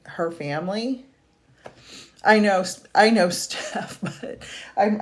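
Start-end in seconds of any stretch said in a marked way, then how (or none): 2.2-5.0 loud bursting "I know, I know" breaking into airy giggles; laughing it off, fighting to stay composed; real laughing. Real, not acted. Medium laughing at a viewer's comment despite trying to be professional. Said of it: "I'm trying to be professional".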